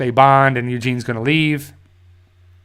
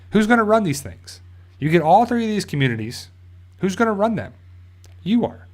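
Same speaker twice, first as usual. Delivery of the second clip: mock challenging Pam; 7 semitones higher than usual. Pam challenging Lance, acted quote.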